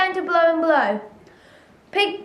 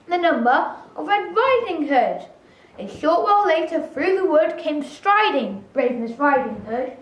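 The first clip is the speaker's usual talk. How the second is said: ordinary delivery, nothing marked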